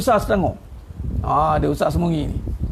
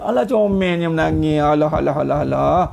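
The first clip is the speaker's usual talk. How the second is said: put-on fond, lower-pitched baby-talk cooing; cooing over a toddler; 3 semitones lower than usual. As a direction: coo as if soothing a crying child.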